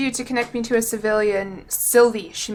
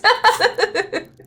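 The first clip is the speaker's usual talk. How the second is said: high giggle; 11 semitones higher, 6 dB louder than usual. Real, not acted. Laughs at sixty cents.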